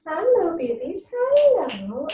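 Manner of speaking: high, sing-song hello to the babies. Real, not acted. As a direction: coo a bright hello to the little ones. Greeting babies in baby talk.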